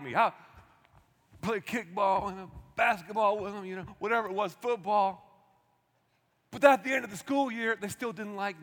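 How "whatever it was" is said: ordinary delivery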